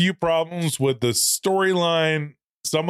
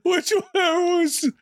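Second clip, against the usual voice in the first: much higher, slow puzzled question; slower than usual. Real, not acted. Co-host asks which Jerry, puzzled and drawn out. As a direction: stretch it out, baffled.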